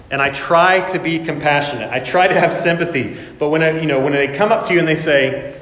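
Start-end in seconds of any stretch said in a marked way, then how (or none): none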